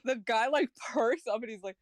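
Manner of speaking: normal talking voice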